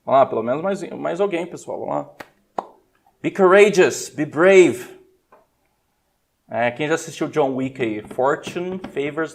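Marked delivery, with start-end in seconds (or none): 3.2-5.0 urging call. Real, not acted. Urging students to answer.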